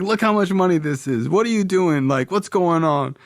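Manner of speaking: imitated dad, high-pitched and demanding; real serious dad. Dad questioning his son about the money, high-pitched and insistent. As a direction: press for answers as an exasperated father. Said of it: "my dad's real serious".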